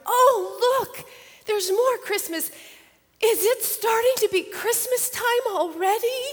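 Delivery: put-on high, loud child's wonder; excited little girl. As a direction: squeal it like a kid seeing Christmas. Girls excited by Christmas decor.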